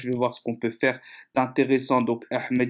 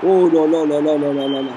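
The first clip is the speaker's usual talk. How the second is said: excited; 4 dB louder than usual. Real, not acted. Reacting to goal.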